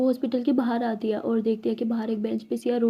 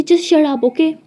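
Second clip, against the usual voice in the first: angry shout; 2 semitones higher, 8 dB louder, much slower than usual. A narrator's reading. Heated quarrel on the bench.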